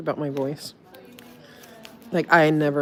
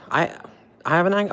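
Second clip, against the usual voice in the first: ordinary talk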